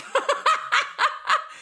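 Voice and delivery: a sudden squealing laugh; high delighted laugh; high burst of laughter. Real, not acted. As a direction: crack up at your own joke. Laughs at her own joke.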